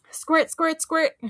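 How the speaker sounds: high, loud playful squirt sound; pretend squirting. Girl pretending to spray seltzer, playful squirt noises. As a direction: make a toy seltzer noise. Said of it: "I said again".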